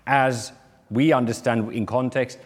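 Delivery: normal talking voice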